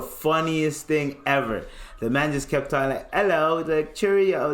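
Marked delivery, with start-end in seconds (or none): 3.1-3.6 higher mock British greeting; British accent. Friend keeping up act for police, British accent. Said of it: "he kept talking in a British accent". 3.9-4.5 high mock British sign-off; British accent. Friend keeping up act for police, British accent. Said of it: "he kept talking in a British accent".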